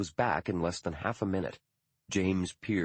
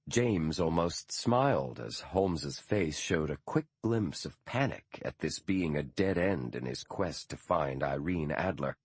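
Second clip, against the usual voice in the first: plain reading voice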